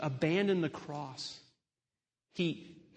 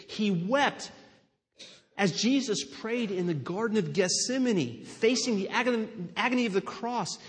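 louder, rising and urgent preaching; preaching at full force; 3 semitones higher, 5 dB louder than usual. Acted. Pastor telling the church of Jesus weeping, louder and urgent. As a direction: build it like a sermon climax.

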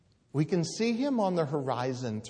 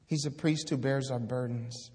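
ordinary talk

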